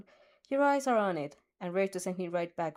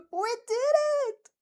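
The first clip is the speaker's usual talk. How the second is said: much higher, louder, slowed cry of joy; elated cheer; 5 dB louder than usual. A narrator's reading. The winner cries out in joy, much higher and louder. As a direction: burst out in triumph. Victory cry after winning.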